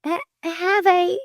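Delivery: high, halting nervous invitation; slow high-pitched stutter; stammering. A narrator's reading. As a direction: stumble over the offer, flustered. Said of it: "she stammered".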